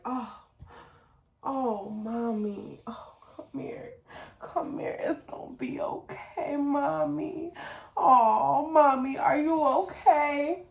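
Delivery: imitating comforting daughter. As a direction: coo it slowly like a concerned little kid. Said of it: "and she'll be like".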